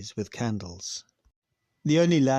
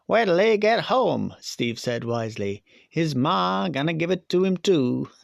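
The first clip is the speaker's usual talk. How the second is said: higher, louder teasing schoolboy; 6 semitones higher, 5 dB louder than usual. Acted. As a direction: tease him like a cocky schoolkid. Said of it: "these are supposed to be school children".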